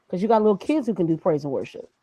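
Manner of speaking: usual speaking voice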